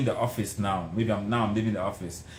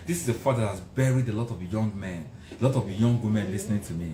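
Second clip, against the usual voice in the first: usual speaking voice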